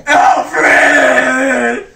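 anguished cry. Acted. Hears butler has died.

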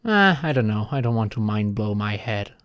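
usual speaking voice

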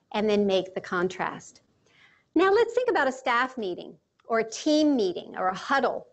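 ordinary talk

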